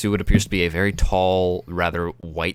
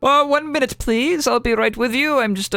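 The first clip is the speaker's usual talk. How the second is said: high, flustered shopkeeper voice; high-pitched and caught off guard; flustered young man; 4 dB louder than usual. Acted. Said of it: "he goes".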